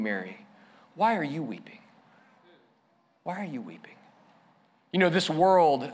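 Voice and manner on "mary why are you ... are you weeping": soft, much quieter, gently asking; tender Jesus. Pretend. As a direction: speak to her like comforting a grieving friend. Jesus gently asks weeping Mary.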